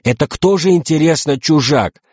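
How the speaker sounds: indignant outcry. A narrator's reading. Two captains turn on each other, indignant outcry. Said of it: "возмущённо заорали".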